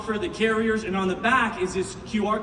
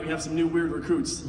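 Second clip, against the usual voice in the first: mock deadpan aside; 7 semitones lower than usual. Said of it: "I was like".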